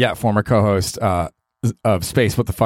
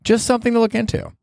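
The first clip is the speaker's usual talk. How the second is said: mock advice.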